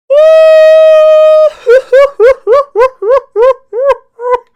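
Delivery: howling sob at full blast, high and loud; a huge, high crying howl; imitated loud wail. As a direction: wail like a grown man breaking down. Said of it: "I just heard Kay crying".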